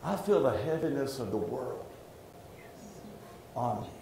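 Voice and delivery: low, hushed, weighed-down confession; subdued and halting, softly confiding; heavy-hearted. Real, not acted. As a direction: let the voice sink under a burden. Confesses a heaviness on him.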